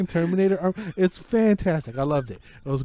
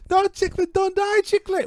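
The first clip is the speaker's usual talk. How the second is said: high mock-panicked yell; rooting cry. Two viewers rooting for Chicklet on the couch. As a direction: beg her not to fall. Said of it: "rooting for Chicklet".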